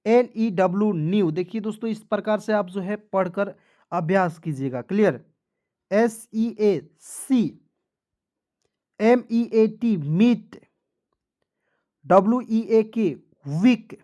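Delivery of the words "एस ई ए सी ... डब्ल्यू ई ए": very slow letter by letter spelling. Shown as a demonstration. Teacher spelling out heat to students, very slow. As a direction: drag each letter for the class. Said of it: "teacher spells slowly in review".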